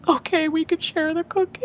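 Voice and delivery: put-on high, small child voice; imitated child relenting. Mom imitating her daughter agreeing to share, high little-kid voice. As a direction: give in like a little girl.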